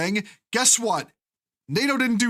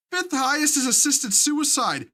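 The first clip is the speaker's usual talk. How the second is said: normal talking voice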